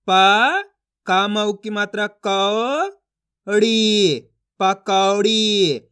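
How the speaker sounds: slow chant, single syllables held long and loud; syllable reading drill. Shown as a demonstration. Teacher modelling word reading for learners, drawn out and emphatic. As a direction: stretch each sound apart, then blend it evenly. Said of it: "इन अक्षरों का उच्चारण कर रहा हूँ".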